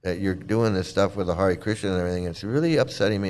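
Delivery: a hint of a tender mother; gentle mother. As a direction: say it quietly, like a caring mom. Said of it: "was like".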